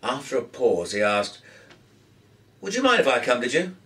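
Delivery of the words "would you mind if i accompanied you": eager, fast question; quick polite request. A narrator's reading. Young man asks eagerly.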